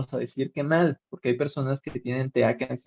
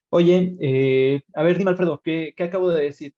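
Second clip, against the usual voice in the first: loud teacher calling on a student; teacher demanding an answer from a student who isn't listening, loud and raised; 4 semitones higher, 5 dB louder than usual. Retold or imitated. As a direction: call out to a distracted student, louder. Teacher calls on distracted student.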